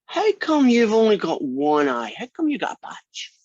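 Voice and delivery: put-on high-pitched kid's questioning; little kid. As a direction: pitch it way up, like an inquisitive child. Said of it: "he says".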